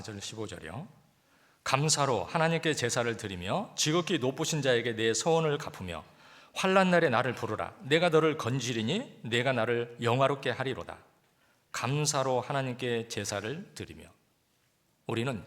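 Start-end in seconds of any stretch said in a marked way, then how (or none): 1.6-11.0 loud, ringing scripture reading from the pulpit; preaching the verse out loud. Acted. Reads scripture at full force. 11.7-14.1 slow emphasis. Acted. Rereads verse slowly for emphasis.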